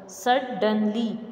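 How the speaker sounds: "Suddenly" split into three slow syllables. Shown as a demonstration. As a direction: sound out "Suddenly" beat by beat. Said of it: "we will pronounce the word".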